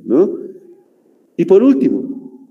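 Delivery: normal talking voice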